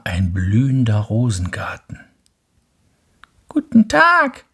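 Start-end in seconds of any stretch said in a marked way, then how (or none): 3.5-4.4 very high, loud chorus voice; roses' voice. Acted. Roses greet the little prince, very high voice. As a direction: sing it out like a bright flower. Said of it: "sagten die Rosen".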